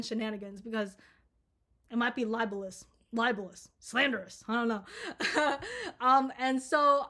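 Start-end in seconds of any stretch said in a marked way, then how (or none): 4.9-6.0 short high nervous laugh. Real, not acted. Vlogger laughing at own libel joke, high laugh.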